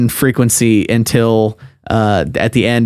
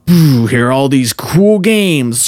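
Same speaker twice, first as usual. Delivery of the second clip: slow, drawn-out mock showy voice; imitating a weird animated mascot; weird animated presenter; 2 semitones higher, slower than usual. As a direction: stretch it out like a goofy animated game presenter. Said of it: "this weird animation of them".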